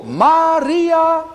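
high, loud call of the name; Jesus calling out to Maria. Acted. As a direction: call her name with tender authority. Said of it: "Wonderlijk en eenvoudig spreekt hij".

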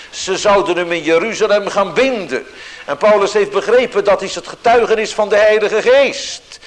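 loud, high, driving preaching; vurig preken. Acted. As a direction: build up the urgency of Paul's journey. Preaching with rising force. (luid en gedreven preken)

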